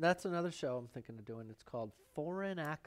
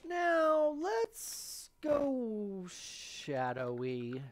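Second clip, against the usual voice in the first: high, very slow and drawn out, louder; mock spooky; 9 semitones higher, 6 dB louder, much slower than usual. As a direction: stretch it out like a spooky spell. Announcing darker painting.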